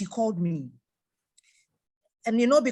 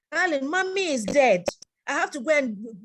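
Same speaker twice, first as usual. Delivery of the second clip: higher, louder mock plea from the wife; pleading wife; 6 semitones higher, 4 dB louder than usual. Wife begs husband to visit her dead mother, pleading mock voice. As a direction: voice the wife begging to go, a bit higher.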